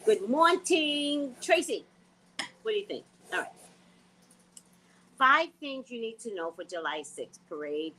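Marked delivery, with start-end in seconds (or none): 0.1-1.8 slow host greeting. Shown as a demonstration. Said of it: "just say good morning".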